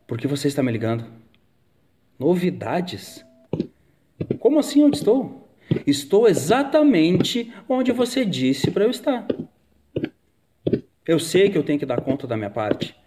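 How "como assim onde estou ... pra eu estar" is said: indignant. Acted. Indignant on phone with partner.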